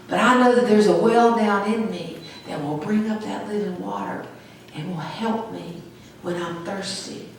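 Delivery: usual speaking voice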